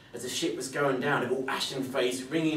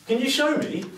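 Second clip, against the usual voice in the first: much higher, louder eager question; keen to see fear at last; eager boy; 13 semitones higher, 8 dB louder than usual. Acted.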